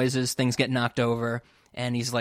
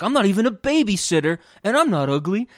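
huffy Corey; 6 semitones higher, 5 dB louder than usual. Retold or imitated. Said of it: "he's like".

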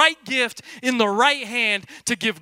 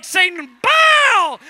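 commanding shout rising high on bow; playful warrior yell at Satan; mock battle cry; 6 semitones higher, 5 dB louder, much slower than usual. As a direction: order the devil down, full volume. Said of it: "I thought she was gonna take her earrings off".

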